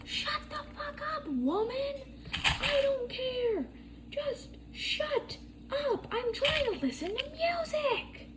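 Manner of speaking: high, squeaky mock yell of a bratty kid. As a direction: squeal it like a whiny angry kid. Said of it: "And he's like".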